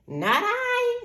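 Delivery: high, honking goose. Acted. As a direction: squawk it out like a silly goose. Goose refuses.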